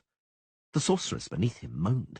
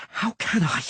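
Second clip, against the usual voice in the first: furious snarl; 11 semitones higher, 4 dB louder than usual. Acted. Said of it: "a furious, muffled snarl".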